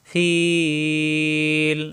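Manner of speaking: ফিল with the long vowel held out; drawn-out recitation. Shown as a demonstration. Teacher demonstrates the long madd, ফিল held out. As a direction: stretch ফিল long like a chant. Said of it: "মিলবে লামের সাথে".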